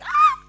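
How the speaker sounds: sudden high squeal of delight; a giddy high-pitched squeal; excited squeal. Retold or imitated. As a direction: shriek inside with joy.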